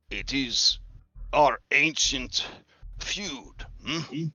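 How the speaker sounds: lightly voiced skeptical old chief; gruff old warrior. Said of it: "He says".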